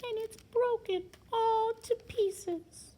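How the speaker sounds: put-on high, slow baby bear wail; wee little voice. A narrator's reading. Baby Bear laments his broken chair, high wee voice. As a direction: drag it out in a tiny high voice. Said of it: "in his wee little voice".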